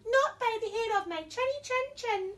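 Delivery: high, squeaky little pig. Acted. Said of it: "said the first little pig".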